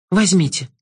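a lightly voiced brave girl; young girl. The girl's voice.

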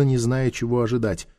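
usual speaking voice

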